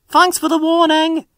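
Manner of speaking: cheerful engine calling back as he puffs off; high toy engine thanks; Stepney voice. Acted.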